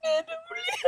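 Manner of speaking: high, soft, broken sob; words squeezed out through real tears; sobbing. Real, not acted. Mother speaking of her child, sobbing softly. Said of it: "அழாதீங்கம்மா".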